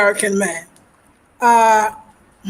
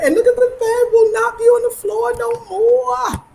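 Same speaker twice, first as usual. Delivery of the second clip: excited squeal; 12 semitones higher than usual. Real, not acted. Celebrating bed off the floor.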